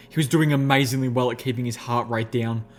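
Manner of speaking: usual speaking voice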